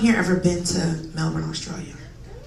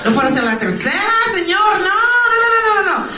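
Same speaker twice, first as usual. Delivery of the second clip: rising shout of refusal; 11 semitones higher than usual. Retold or imitated. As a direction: yell the refusals ever higher. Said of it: "refusing a proposal".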